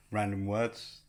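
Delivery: normal talking voice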